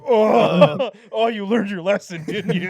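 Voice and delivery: high, loud, laughing coo; cooing at a Bill Murray figure; mock tearful praise. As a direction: gush over Bill like a proud parent. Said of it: "and you're like".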